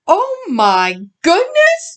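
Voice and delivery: high, slow delighted gasp of surprise; exclaiming. A narrator's reading. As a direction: stretch it out in happy shock. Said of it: "Mama exclaimed".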